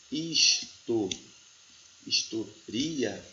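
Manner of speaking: "historia" without the accent, stretched very slowly, syllables split his-to-ri-a; hypothetical word split. Shown as a demonstration. Teacher slowly splits the unaccented historia for students. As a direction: say "historia" very slowly, breaking up the final vowel pair. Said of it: "se fosse a palavra".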